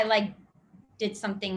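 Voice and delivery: normal talking voice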